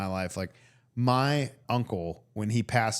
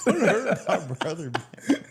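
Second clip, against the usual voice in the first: slow, raised mock plea; echoed plea; 7 semitones higher, 4 dB louder, slower than usual. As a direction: drag it out as a laughing imitation. Echoing the prank-call voice.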